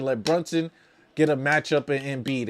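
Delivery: usual speaking voice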